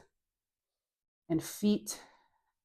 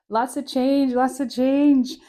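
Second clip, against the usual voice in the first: loud callout; 6 semitones higher, 8 dB louder, slower than usual. Acted. Instructor calling out, repeated.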